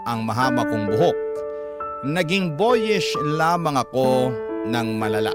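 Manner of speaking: ordinary talk, nothing marked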